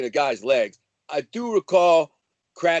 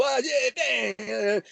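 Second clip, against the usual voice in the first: a light, higher impression of a club show-off; mocking tough guy; 7 semitones higher, 4 dB quieter, slower than usual. Mocking a fake tough guy at the club, lightly voiced. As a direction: lightly imitate a smug gym bro. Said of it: "The kind of guy who".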